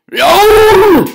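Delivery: very high, loud howl of pain; pained yell. Acted. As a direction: yelp as teeth break. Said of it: "shouted Cucullin".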